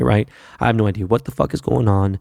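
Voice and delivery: usual speaking voice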